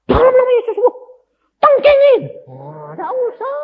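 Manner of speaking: very high, loud hammed-up exorcism voice; mock high shouted prayer, then dismissive; mock rebuke prayer. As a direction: parody a shouting healer. Mocking loud healing prayer.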